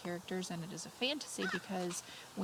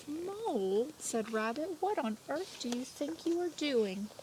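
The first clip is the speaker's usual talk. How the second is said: higher, exasperated rabbit voice; annoyed Rabbit; 7 semitones higher than usual. Acted. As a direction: scold Mole as a sleepy cross rabbit. Rabbit woken, scolding Mole.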